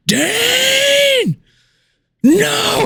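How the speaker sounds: high, desperate scream of a son's name; father crying out for his fallen son; anguished cry. Acted. King cries out for fallen son.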